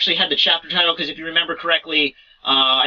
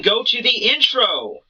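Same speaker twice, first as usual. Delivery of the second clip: drawn-out, higher cue to roll the intro; intro call-out; 7 semitones higher, slower than usual. Pretend. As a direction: call it out high and stretch it. Cues the video intro.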